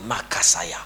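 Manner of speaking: whispered mock prayer; whispered tongues. Pastor mocking a praying prophet, whispered. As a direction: breathe it out secretly.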